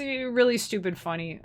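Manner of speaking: ordinary talk, nothing marked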